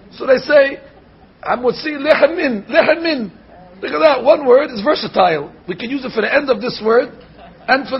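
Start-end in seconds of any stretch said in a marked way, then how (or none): 1.4-3.3 "Lechem Min" with one shared m, laughing; mock the lazy merging with a laugh; merged Mem, mocking. Rabbi mocking people who merge letters. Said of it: "Why waste two Mems".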